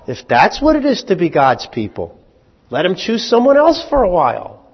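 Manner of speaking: loud, high mock Tevye lament. Tevye complaining to God, loud and high. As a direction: cry it to heaven like Tevye. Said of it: "Rateviev says there in".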